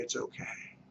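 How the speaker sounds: it's okay whispered under the breath; whispered reassurance. Shown as a demonstration. Agent soothing a worried seller, whispering it's okay.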